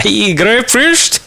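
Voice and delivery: sudden squeal jumping way up; a drunk squealing high through the wall. Acted. A drunk neighbour squealing in the night, high and shrill.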